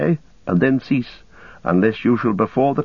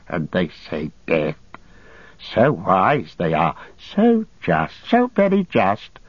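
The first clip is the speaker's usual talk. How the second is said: Gollum, pitiful and bitter; 2 semitones higher, slower than usual. Acted. Starving captive whining, then sneering at his judges' justice. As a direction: moan of hunger, then mock the wise and just.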